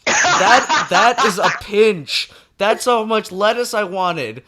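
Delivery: high, loud and slow exasperation; exasperated. Retold or imitated. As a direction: spell it out to the clerk in outrage. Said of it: "I'm like".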